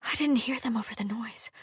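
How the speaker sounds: hushed breathy whisper, no voice. A narrator's reading. Hala explaining to Sarkis in hiding, whispered. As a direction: whisper it so the constables can't hear. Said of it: "she whispered".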